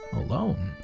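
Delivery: ordinary delivery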